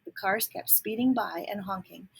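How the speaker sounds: ordinary delivery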